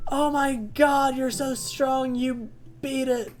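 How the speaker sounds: high, hammed-up cheering doctor; high mock-gushing doctor praising a kid; hyped doctor. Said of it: "and he's like".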